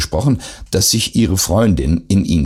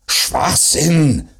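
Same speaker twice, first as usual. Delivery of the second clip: high, raised mock yell; gebrüllt; 8 semitones higher than usual. A narrator's reading. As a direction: bark it out in a restrained read. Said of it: "brüllte er". (kurz gebrüllt, vorgelesen)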